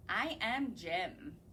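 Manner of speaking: usual speaking voice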